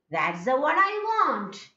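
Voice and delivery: demonstrated arched tune ending in a fall on "want"; rising-falling tone. Shown as a demonstration. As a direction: climb and hold, then let "want" drop. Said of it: "I am rising it".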